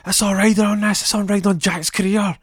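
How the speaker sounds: high, loud coach shout; coach yelling. Retold or imitated. Coach yelling at Peter about Jack's career, loud. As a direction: bellow it at the kid. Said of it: "He's like".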